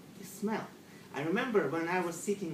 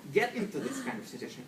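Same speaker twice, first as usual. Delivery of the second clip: usual speaking voice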